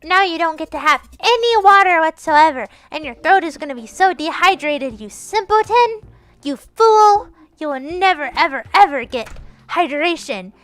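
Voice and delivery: high, loud, hammed-up scolding; mock tirade. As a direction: pronounce a mock doom on them, loud and overblown. Mock scolding of a viewer, calling names.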